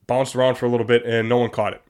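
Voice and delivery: usual speaking voice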